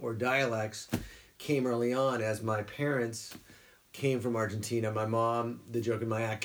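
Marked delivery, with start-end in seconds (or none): none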